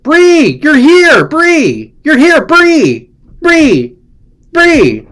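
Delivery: high, loud shrieked name calls; woodchuck video scream. Pretend. Calling out to Bree like the screaming woodchuck video, high and loud. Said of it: "It's like that woodchuck video".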